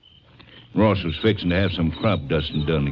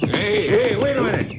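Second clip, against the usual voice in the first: calling out. Acted. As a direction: shout to stop them riding off. Rancher calls after departing riders.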